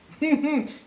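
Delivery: high loud laugh; a real burst of laughter. Real, not acted. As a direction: crack up. Laughing at the angry client.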